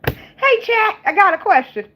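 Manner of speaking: high, loud hail to a chatbot; playful calling out. Acted. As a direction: call out brightly and high, playfully.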